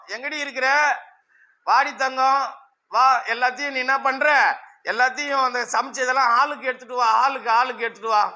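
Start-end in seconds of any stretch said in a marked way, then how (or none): none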